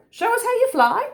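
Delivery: high, eager bird character; urging Kookaburra. A narrator's reading. Kookaburra urging little Joey to fly, high and insistent. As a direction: egg Joey on in a high story voice.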